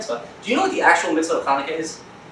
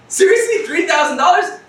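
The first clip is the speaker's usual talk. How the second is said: much higher, louder disbelief; shocked disbelief; 12 semitones higher, 5 dB louder than usual. Retold or imitated. Customer shocked at the three-thousand-dollar etrog price. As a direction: gasp at the price. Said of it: "You're like".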